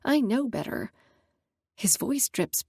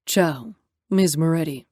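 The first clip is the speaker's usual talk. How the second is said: lightly voiced older driver, a touch lower; driver's greeting; 4 semitones lower than usual. Older driver greeting the boss's daughter, lightly lower voice. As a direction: greet her politely as the chauffeur. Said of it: "The driver greets Natalia".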